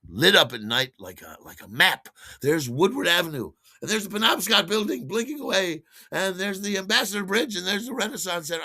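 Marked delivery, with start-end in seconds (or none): 2.4-8.6 high, wondering voice naming each landmark; awestruck sightseeing. Retold or imitated. Tripping over Detroit at night, naming landmarks high and awestruck. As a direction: gasp at each landmark from the plane window. Tripping, marvelling at city lights.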